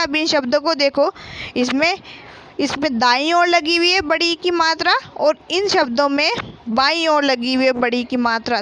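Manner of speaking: normal talking voice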